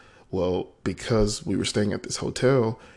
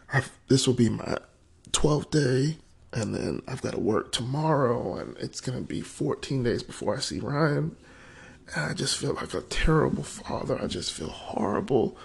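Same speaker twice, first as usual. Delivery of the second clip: higher-pitched, really choked-up confession; tearful; 6 semitones higher than usual. Real, not acted. Father missing his son confides in a friend, tearful. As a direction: confess it while fighting back real tears. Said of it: "Started crying on that one".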